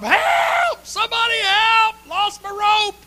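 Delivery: high, loud, drawn-out cries for help; desperate hollering. Acted. Climber dangling from a branch hollering up the cliff for help. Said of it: "he's hollering up the top".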